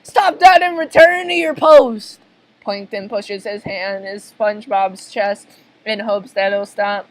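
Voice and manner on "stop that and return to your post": high, loud barked order; forceful cartoon command; commanding retake. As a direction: shout the order with full authority. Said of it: "why did I say that like that?".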